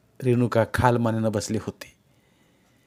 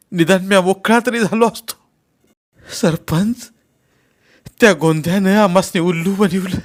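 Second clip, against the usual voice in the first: high, raised, grief-stricken voice; acted weeping lament; 8 semitones higher, 6 dB louder than usual. A narrator's reading. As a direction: wail it out like a betrayed father. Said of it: "ओक्साबोक्शी रडू लागला". (रडत, आक्रोश करत)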